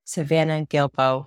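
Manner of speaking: normal talking voice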